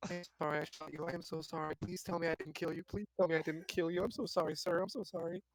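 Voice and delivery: softly muttered apology under the breath; under his breath. Acted. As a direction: mumble a guilty apology, barely audible. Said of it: "from under my breath".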